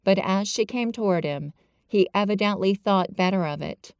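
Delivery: ordinary delivery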